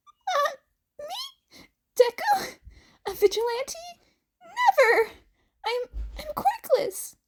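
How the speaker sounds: very high, cracking nervous laugh; panicked denial. Acted. Izuku frantically denying he is a vigilante. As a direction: deny it in a squeaky panic.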